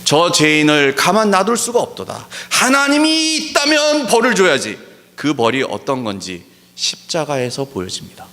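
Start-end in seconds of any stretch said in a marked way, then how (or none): 0.0-4.8 mocking quote. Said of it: "하나님의 정의를 모르는 사람".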